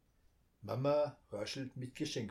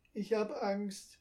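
put-on higher, slow timid child voice; scared little girl; 9 semitones higher than usual. A narrator's reading. Frightened girl clings to her brother's hand. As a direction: say it small and scared.

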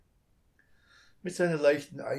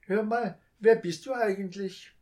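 put-on high, louder little child's question; curious child; 8 semitones higher, 8 dB louder than usual. A narrator's reading. Child asks the thief who he is. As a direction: ask like a curious small child. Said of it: "child asks thief".

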